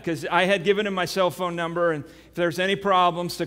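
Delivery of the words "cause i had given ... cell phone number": usual speaking voice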